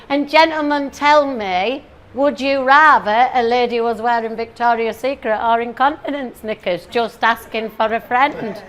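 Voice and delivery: ordinary delivery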